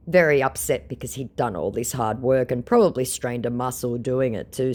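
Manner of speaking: usual speaking voice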